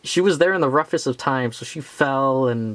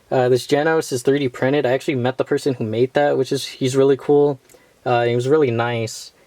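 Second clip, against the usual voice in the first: normal talking voice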